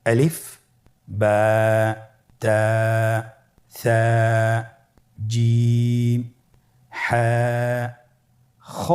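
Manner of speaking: slow, clear letter names for repeating; spelling out the Arabic letters one by one; letter names drilled. Shown as a demonstration. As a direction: say each letter alone, clearly, for the class to echo. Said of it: "সকলে আমার সাথে বলুন".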